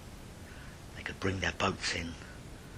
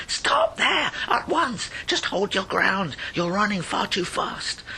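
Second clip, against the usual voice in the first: much higher and loud, a frantic yell; yelling excise man; 14 semitones higher, 7 dB louder, slower than usual. Acted. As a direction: scream after him as he runs. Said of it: "the excise man did yell".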